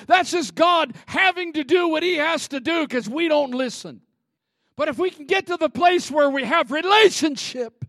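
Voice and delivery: loud, high, driving sermon; fiery pulpit delivery; full-force preaching. Acted. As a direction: preach it hard.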